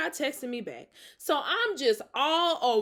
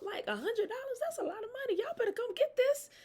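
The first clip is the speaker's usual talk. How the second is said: much higher, softer, laughing disbelief at the price; excited ad hype; 11 semitones higher, 7 dB quieter, faster than usual. Real, not acted. Pitching the therapy discount to her viewers, high and giddy. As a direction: squeal it like it's too good to be true.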